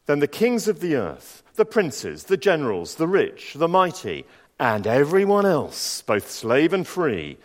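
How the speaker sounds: solemn, measured Bible reading; scripture reading. Acted. Preacher reading Revelation to the congregation, solemn. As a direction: read the Revelation passage with weight. Reads scripture aloud in church.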